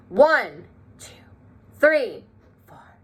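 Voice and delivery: One, two, three called out loudly; loud counting. Shown as a demonstration. Teacher leading class, counting the notes loud. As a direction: count the numbers out strong. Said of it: "count the notes loud".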